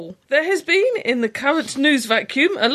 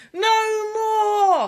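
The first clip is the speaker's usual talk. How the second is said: high, drawn-out mock wail; theatrical cry; 9 semitones higher than usual. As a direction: cry out in hammed-up despair. Mock plea ending the letter.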